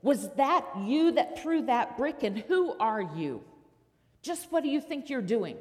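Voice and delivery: shouting. Acted. Josh shouting at the boy who threw the brick, loud and angry. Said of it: "he shouted at the kid".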